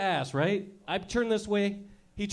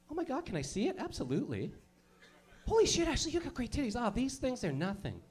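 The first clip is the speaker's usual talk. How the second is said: put-on 1 girly friends; 6 dB quieter than usual. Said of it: "She looks at you like".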